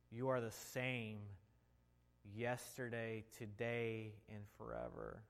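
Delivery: hushed pastor's prayer; leading prayer. Acted. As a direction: pray aloud for the congregation. Leads the congregation in prayer.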